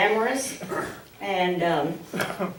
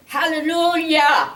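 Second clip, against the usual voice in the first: higher, ringing hallelujah; mock preacher shout; 9 semitones higher, 3 dB louder than usual. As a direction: cry out like a preacher. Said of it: "doing preacher".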